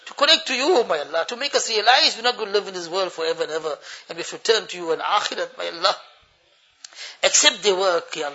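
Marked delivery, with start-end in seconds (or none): none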